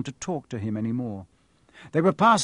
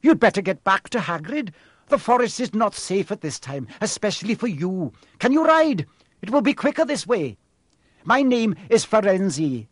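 higher, louder urgent centaur; a lightly voiced noble centaur hurrying the boy; 7 semitones higher, 5 dB louder than usual. A narrator's reading. Centaur warns the boy to leave, lightly voiced urgent creature. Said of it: "he added".

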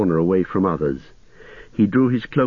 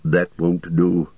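slow, low, stern wizard; grave reprimand in a lower voice; 4 semitones lower, slower than usual. A narrator's reading. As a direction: reject it firmly, taking your time. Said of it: "said Gandalf sternly".

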